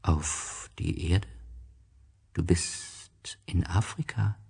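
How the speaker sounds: slow, soft, unvoiced snake whisper; the snake telling the prince where he is, whispered. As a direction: hush it slowly like a snake in the dark. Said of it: "antwortete die Schlange".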